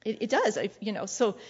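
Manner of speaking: ordinary talk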